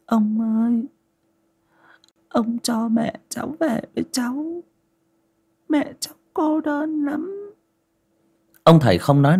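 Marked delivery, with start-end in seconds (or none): 0.1-7.5 put-on very high, thin little boy's voice, choked with tears; choked child voice. A narrator's reading. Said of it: "Giọng nó nghẹn ngào".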